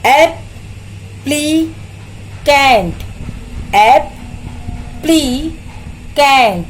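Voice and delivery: Applicant in three very slow beats; long pauses between एप, ली and Kent; syllable-by-syllable. Shown as a demonstration. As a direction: sound out applicant piece by piece. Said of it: "कितने sound है".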